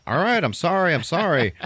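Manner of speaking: high, loud mock pleading; panicked apology. He's ordered out of car, panicked apology. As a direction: blurt the apology in pretend panic. Acted frantic apology after outburst.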